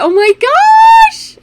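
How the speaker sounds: drawn-out, very high, loud gasp of awe. Retold or imitated. Watching the archer, stretched-out high gasp. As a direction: stretch it out in amazement.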